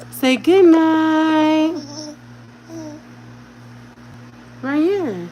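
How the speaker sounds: stretched mother's coaxing voice; baby talk. Shown as a demonstration. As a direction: draw out goodnight for the baby.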